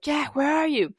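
mock frantic call across a fight. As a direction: yell for the missing protector in alarm. Calling for her missing protector.

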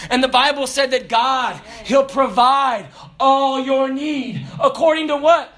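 preacher building to a call and response, loud; full-force preaching. Acted. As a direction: preach it out to the congregation.

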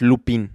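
Lupin the Spanish way, "lu-PEEN"; pronunciación española. Shown as a demonstration. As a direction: end Lupin on a plain Spanish "pin". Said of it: "en español es". (Lupin en español, como «lupín»)

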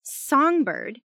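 high, indignant name-call; accusing start. A narrator's reading. Jazz starting to blame Songbird, indignant.

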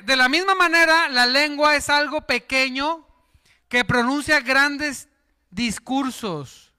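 solemn preacher's reading; scripture reading. Acted. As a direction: read the verse with weight. Reads scripture.

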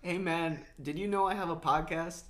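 loud, high, hyped greeting; excited greeting. Real, not acted. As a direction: pump it up for a friend. Greeting friend on call.

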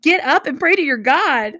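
high, loud urgent command; shouted order. A narrator's reading. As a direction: shout him out of bed. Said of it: "he shouted".

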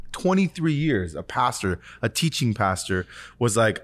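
normal talking voice

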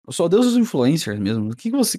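normal talking voice

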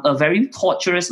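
ordinary talk, nothing marked

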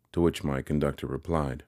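usual speaking voice

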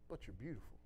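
hushed, tender reassurance; tender voice of God. Retold or imitated. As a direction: speak it like a loving whisper of comfort. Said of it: "and he said".

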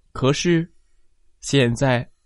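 ordinary delivery

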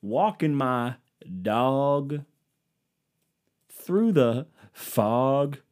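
slow Southern country drawl, sung-spoken; mock Southern country crooner; southern twang. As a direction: croon it like a lazy country singer. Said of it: "southern twang to my voice".

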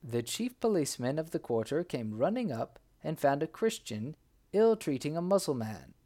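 ordinary delivery

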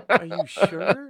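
high-pitched, doubting stoner imitation; incredulous high guy. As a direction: question it like a baffled stoner. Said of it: "and the high guy".

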